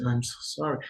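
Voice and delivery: very soft, hushed apology; almost breathe it to them; soft sorry. Shown as a demonstration. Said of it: "you look them in the eye and you say".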